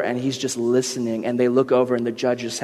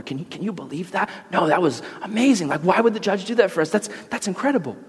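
amazed convicts; 4 semitones higher, 3 dB quieter than usual. Acted. Convicts marvel outside courtroom.

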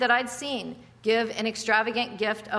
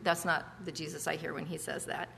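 normal talking voice

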